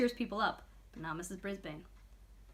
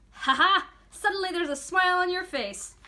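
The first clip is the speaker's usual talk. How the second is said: loud, high, beaming laugh; delighted laugh; 8 semitones higher, 8 dB louder than usual. A narrator's reading. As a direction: cheer at your little buddy's grin. Aldo delighted by hamster.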